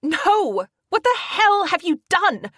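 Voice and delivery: shouting furiously into the phone at Carter. A narrator's reading. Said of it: "I shout into the phone".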